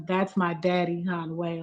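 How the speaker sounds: ordinary talk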